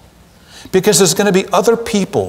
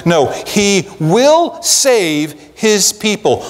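"will" hit hard, slow and certain; slow insistence on will; WILL stressed against might; 4 semitones higher, slower than usual. Shown as a demonstration. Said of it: "he might save some people".

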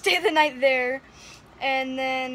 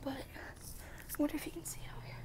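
hushed whisper, much softer; 19 dB quieter than usual. Real, not acted. Outside after the storm, she whispers to the camera. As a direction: whisper so nobody outside hears. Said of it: "I'm gonna be a little quiet".

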